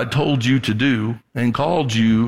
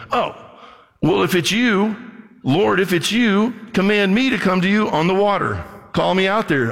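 higher-pitched, lightly acted eager request; eager disciple; 7 semitones higher than usual. Peter calls to Jesus from the boat, lightly acted eagerness. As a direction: lift the pitch as if proposing a bold idea.

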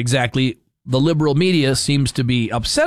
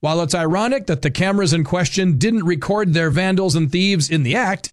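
higher-pitched, punchy announcer read; scripted radio commentary; 6 semitones higher than usual. Acted. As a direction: deliver a prepared segment without letting up. Reads his prepared commentary segment.

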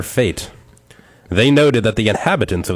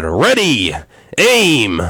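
louder, rising mock command; captain's shout; 5 semitones higher, 4 dB louder, much slower than usual. Captain commanding the firing squad again, loud mock command. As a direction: bark the order to the squad. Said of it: "Again, the captain shouted".

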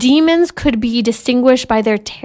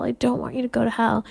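softer, fast pleading repeat; soft plea; 8 dB quieter, faster than usual. Retold or imitated. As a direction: murmur the plea again, hushed and quick. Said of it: "rocking her in my arms before bed".

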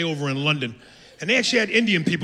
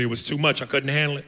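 ordinary talk, nothing marked

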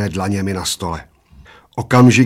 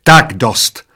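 loud, abrupt protest; lightly acted waitress yell; mild mock shriek; 2 semitones higher, 7 dB louder than usual. A narrator's reading. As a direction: cry out to stop them, loudly. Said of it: "zaječela číšnice".

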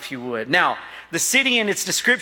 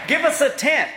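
higher, punchy joke; playful exclaim; 7 semitones higher than usual. Real, not acted. Pastor joking to the men about a tent, playful. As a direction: toss it out as the punchline.

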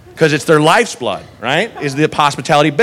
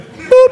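high boop; 4 dB louder than usual. Acted. Comic sound after the cutoff.

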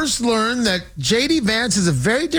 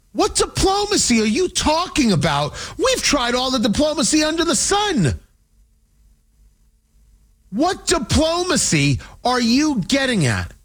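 exasperated outburst; 6 semitones higher than usual. Real, not acted. Host yelling at Vance's diplomacy claim in exasperation. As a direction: throw the question back at him, fed up.

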